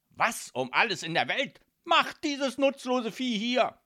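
high, loud outraged father; exasperated mafia boss at full volume; furious screaming. A narrator's reading. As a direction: yell the demand in disgust. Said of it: "schrie Don Calzone".

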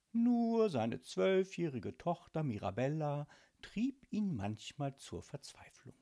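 ordinary delivery

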